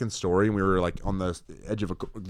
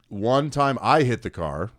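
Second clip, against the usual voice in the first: ordinary talk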